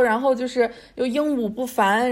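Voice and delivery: usual speaking voice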